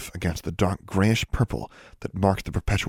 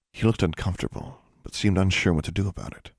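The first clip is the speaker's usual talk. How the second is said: usual speaking voice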